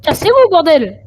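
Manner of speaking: high angry yell; raging gamer. Real, not acted. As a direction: shout them away. Shouting at enemy players.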